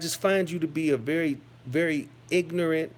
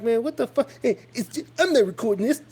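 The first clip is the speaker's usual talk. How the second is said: raised, higher reenacted protest; retelling own reply; 7 semitones higher, 3 dB louder, faster than usual. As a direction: replay your own startled retort, pitched up. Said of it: "I'm like".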